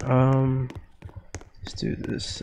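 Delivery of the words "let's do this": whispering. Real, not acted. Sneaking past enemy, whispering.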